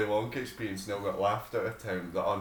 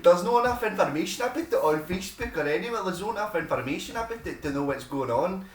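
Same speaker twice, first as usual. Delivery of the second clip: mock complaining woman; 7 semitones higher than usual. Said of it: "she's like".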